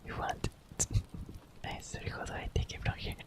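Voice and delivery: ordinary talk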